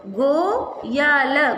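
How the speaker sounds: गो या ल spaced out slowly; teacher sounding out Goyal; syllable by syllable. Shown as a demonstration. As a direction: sound out गो या ल piece by piece. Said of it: "इसका उच्चारण होगा".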